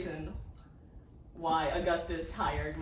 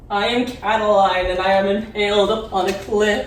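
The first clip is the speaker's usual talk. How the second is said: loud tormented villain; 12 dB louder than usual. Acted. Playing Catiline in show.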